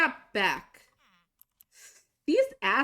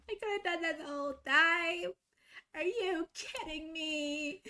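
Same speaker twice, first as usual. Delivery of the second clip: mock-stunned realization; exasperated; 7 semitones higher than usual. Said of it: "just sitting here like".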